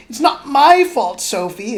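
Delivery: high, loud, agitated fire demon; a fretful little flame pleading, loud and high; agitated crackling voice. Acted. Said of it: "in an agitated, crackling voice".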